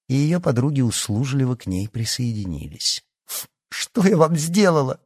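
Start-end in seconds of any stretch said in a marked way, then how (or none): 3.7-5.0 high, loud, hurt protest; wounded outcry, pitched up; bitter exclamation. A narrator's reading. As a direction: cry out bitterly at your tormentors. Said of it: "с горечью воскликнула Яна".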